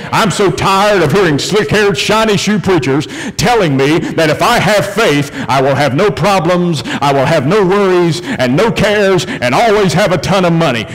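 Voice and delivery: impassioned pulpit crescendo; full-force preaching. Acted. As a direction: build from exasperation to triumphant proclamation.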